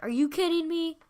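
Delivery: high, loud, stressed on kidding; high loud indignant shout; loud outburst. Retold or imitated. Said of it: "I didn't say".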